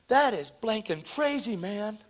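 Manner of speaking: high-pitched retold amazement; mildly acted astonished outburst; freaking out. Student stunned by an accurate prophecy, acted freak-out. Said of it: "she's freaking out because it's true".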